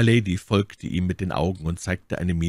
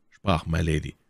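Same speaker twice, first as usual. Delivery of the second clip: ordinary delivery, nothing marked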